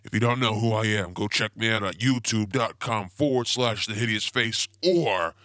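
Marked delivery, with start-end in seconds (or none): 4.8-5.3 or said louder and held as its own word; stress on or. Shown as a demonstration. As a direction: lean hard on or before the pause. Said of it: "I emphasize the word or".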